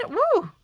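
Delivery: short, high-pitched whoop; celebratory woo. Real, not acted. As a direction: give a quick high cheer. Pleased the stencil lines up.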